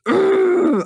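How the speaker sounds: sudden high, loud groan of frustration; mock frustrated groan; exasperated ugh. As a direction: let out a fed-up ugh. Venting over ranting, acted groan.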